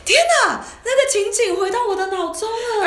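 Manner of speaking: oh my god, it all comes back; excited. Real, not acted. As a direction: squeal it as the memory floods back. Memory suddenly comes back.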